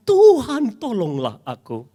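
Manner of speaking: high, loud desperate plea; a sinking man calls for rescue; cry for help. A narrator's reading. Peter sinking in the waves, loud desperate cry. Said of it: "lalu berteriak".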